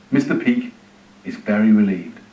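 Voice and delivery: ordinary delivery, nothing marked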